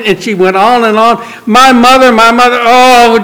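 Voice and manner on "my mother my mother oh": exasperated mock rant, pitched right up; angry venting. Janet going on and on about her mother, angry. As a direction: mimic a worked-up daughter, high and loud. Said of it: "and she just angry like this".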